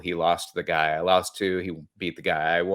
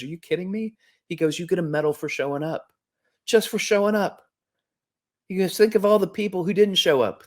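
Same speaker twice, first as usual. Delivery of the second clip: son's voice; 8 semitones higher than usual. Retold or imitated. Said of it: "he looks at me and he goes".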